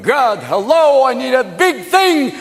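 high, slow, stretched-out call; calling to God. Acted. Moses calls to God for help, high drawn-out holler. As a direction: holler up high, dragging out the words. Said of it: "called to God".

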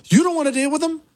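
very high-pitched complaining whine; mock whiny protest. Host voices objection to dealing with them, high mock whine.

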